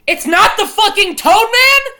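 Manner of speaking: high, loud mock outrage; exasperated shout. Real, not acted. Streamer scolding himself for a wrong quiz guess, shouting. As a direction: yell it like the answer offends you.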